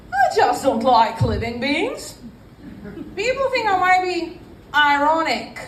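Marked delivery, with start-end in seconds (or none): 0.1-2.2 put-on British accent with rising end. Shown as a demonstration. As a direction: lift the ending in a mock British accent. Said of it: "if I say with a kind of British accent".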